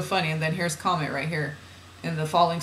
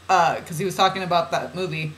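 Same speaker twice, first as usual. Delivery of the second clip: ordinary talk, nothing marked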